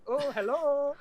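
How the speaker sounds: high, put-on Bretman Rock accent; a squealed, high mock greeting in his accent; playful high-pitched accent impression. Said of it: "that Brett Rockman accent".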